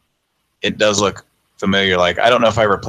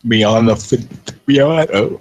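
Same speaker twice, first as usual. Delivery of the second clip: words stretched by a yawn; 3 semitones higher, much slower than usual. Real, not acted. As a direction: read it mid-yawn, slowly. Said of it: "I'm yawning".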